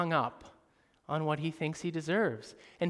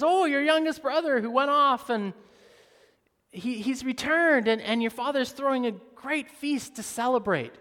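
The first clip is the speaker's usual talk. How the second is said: servant explaining; 8 semitones higher, 7 dB louder than usual. Retold or imitated. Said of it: "And he says".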